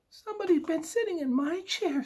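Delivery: very high, fast cub voice; tiny high wail; Baby Bear. Acted. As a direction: squeal like an upset little cub. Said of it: "said Baby Bear".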